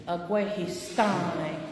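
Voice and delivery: "Agwehistane" drawn out slowly, every syllable spelled out, tone change on "sta" made plain; exaggerated pronunciation. Shown as a demonstration. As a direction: stretch "Agwehistane" slowly like a teacher. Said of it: "Make it big when you're learning".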